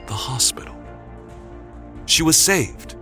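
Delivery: usual speaking voice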